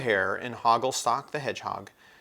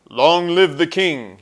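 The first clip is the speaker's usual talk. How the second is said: loud, slow royal cheer; cried out; 7 semitones higher, 14 dB louder, slower than usual. A narrator's reading. Centaur hailing the king, loud and slow. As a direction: hail the king with full voice. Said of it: "he cried".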